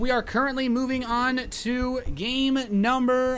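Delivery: loud, high arena announcer voice; hype announcer. Acted. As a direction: pump up the crowd like a ring announcer. Caster opening the broadcast.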